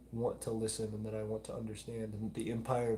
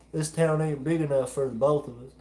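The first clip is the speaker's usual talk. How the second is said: louder, higher Southern cowboy line; big Southern cowboy accent, louder and higher; hammed-up cowboy drawl; 4 semitones higher, 10 dB louder than usual. Shown as a demonstration. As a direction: play a movie cowboy in a showdown. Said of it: "I can certainly turn it up".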